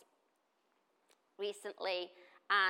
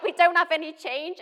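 exclaimed reply; 8 semitones higher, 16 dB louder than usual. Retold or imitated. Mother answering daughter in worship.

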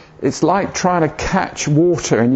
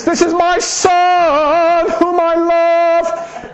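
much higher, slow, loud proclamation; great voice of God; 4 dB louder, much slower than usual. Retold or imitated. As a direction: announce it slowly like a voice booming from heaven. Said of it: "And this great voice came out".

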